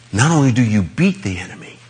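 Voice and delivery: ordinary talk, nothing marked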